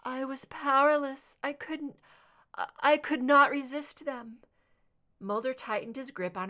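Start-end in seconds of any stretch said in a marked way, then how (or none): 0.0-4.4 pained, darkened. Acted. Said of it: "her voice softened and darkened with pain".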